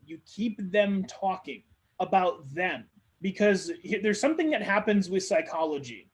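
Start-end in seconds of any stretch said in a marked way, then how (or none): none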